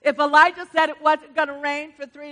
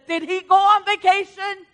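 mocking; 6 semitones higher, 5 dB louder than usual. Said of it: "He's mocking them".

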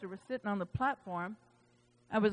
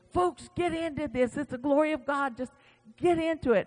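retold pastor calling out to the folks, high and excited; rousing call; 6 semitones higher than usual. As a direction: fling it out like a pastor swept up in glory. Pastor voiced calling congregation in.

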